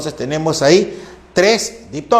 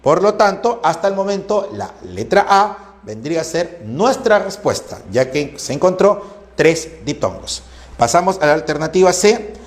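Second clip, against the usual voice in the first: ordinary delivery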